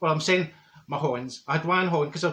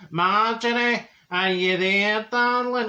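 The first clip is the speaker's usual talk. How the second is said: mock ghostly voice; 5 semitones higher, 3 dB louder, slower than usual. Voice at seance, neighbour actually.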